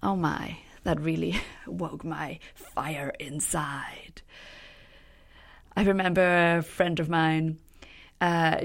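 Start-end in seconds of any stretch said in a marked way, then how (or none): 0.8-4.2 slow, breathy whisper through a laugh; whispered aside. Real, not acted. To her viewers about the study, a slow laughing whisper. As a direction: hush it, amused and stirred.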